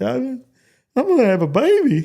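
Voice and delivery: high-pitched retold wail; upset. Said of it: "I'm all upset".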